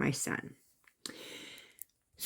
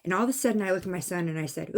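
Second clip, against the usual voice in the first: normal talking voice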